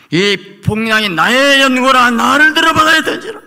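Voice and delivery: 높고 크게 터져 나오는 고백; 목청껏 높이 외치는 요나의 고백; 절규하는 요나. Acted. 요나의 고백을 높게 외침. (폭풍 속 배에서 뱃사람들에게 외치는 요나, 높고 큰 절규)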